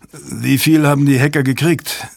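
a touch of worry in the question; worried. A narrator's reading. Fontaine to Jabba after the rescue, mildly worried. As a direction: ask with mild, understated concern. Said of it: "fragte Fontaine besorgt".

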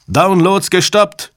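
slow, loud, high-pitched announcement; shouted report. A narrator's reading. Technician to the room as the attack halts, shouting. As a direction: call out the news, stretching the words. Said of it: "schrie ein Techniker".

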